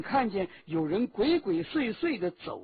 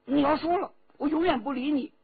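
raised, higher-pitched huffy threat; angry; 8 semitones higher, 5 dB louder, faster than usual. A narrator's reading. Girl threatening never to speak to him, angry. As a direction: flare up in a high, loud voice. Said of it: "周启发怒了".

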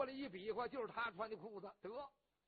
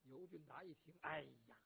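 ordinary delivery, nothing marked

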